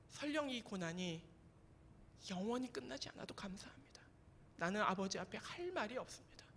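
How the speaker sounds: barely voiced, trembling-soft plea; a hushed, awed prayer to God. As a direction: whisper the prayer as if afraid to speak. Fearful prayer retold.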